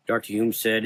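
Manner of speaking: ordinary delivery, nothing marked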